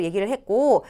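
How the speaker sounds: ordinary talk, nothing marked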